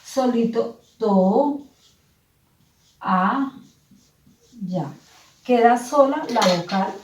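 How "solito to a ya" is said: slowly "to, a, ya", with long pauses between syllables; slow syllable split. Shown as a demonstration. Teacher slowly showing pupils the hiatus, syllables apart. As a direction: stretch the word out to set each syllable apart. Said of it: "Fíjense ustedes que quedaría".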